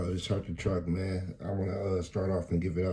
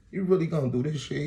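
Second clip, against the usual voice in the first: incredulous; 9 semitones higher, 3 dB louder than usual. Retold or imitated. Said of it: "I'm like".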